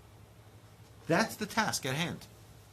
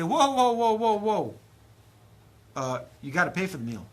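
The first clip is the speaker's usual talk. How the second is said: higher, louder whoa whoa; mock host stopping guests; 3 semitones higher, 5 dB louder than usual. Abraham stopping departing guests, higher and louder. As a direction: halt the leaving guests. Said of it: "he'd say".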